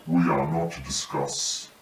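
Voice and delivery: ordinary talk, nothing marked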